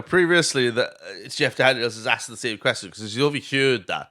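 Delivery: ordinary delivery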